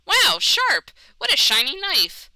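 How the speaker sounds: thrilled by something shiny; excited. Acted. Magpie thrilled by the shiny knife, excited.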